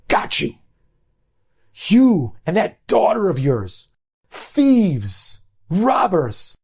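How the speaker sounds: high-pitched, indignant accusation; accusing outburst. Retold or imitated. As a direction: act out catching a thief red-handed. Cashier publicly accuses a shopper.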